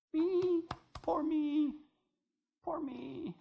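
self-pity whine. Retold or imitated. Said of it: "poor memes".

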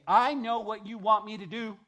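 loud, high mock defiance; mock proud defiance. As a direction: throw the proud man's words out loud. Mocking a prideful person.

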